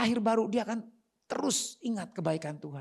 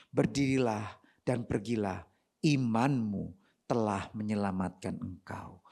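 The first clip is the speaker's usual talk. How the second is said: slow, low, measured blessing; gentle solemn assurance; 10 semitones lower, slower than usual. Acted. Jesus reassuring the healed foreigner, gentle and solemn. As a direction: pronounce it slowly in a low voice.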